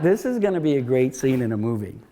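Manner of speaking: chuckling through the line; laughing. Retold or imitated. Writer retelling his joke to the audience, laughing. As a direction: laugh as you say it, tickled by yourself.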